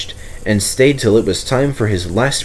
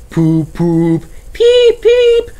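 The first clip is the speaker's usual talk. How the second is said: high, louder whistle noises; excited engine whistles; 5 dB louder, much slower than usual. Acted. Two engines whistling a welcome, excited and high. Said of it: "whistled excitingly in welcome".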